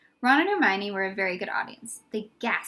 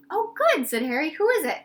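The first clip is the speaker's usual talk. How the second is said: pleased; 5 semitones higher, 4 dB louder than usual. Acted. Harry pleased at visitor.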